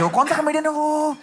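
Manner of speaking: high-pitched teasing question; put-on high voice; mock curious onlookers. Acted public asking about the comedian.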